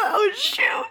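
sudden high yelp; surprised yelp. Real, not acted. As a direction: blurt it caught out. Caught out, laughing.

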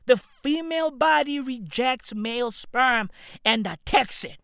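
normal talking voice

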